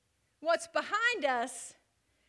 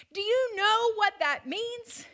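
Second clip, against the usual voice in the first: louder and higher preaching; preacher thundering about the storm; preaching at full force; 6 semitones higher, 7 dB louder than usual. Acted. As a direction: drive it home with full force. Preaching builds again.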